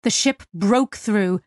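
ordinary delivery

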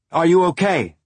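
high, loud shout. Acted. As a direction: shout it over deafened ears. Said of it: "Holden shouted".

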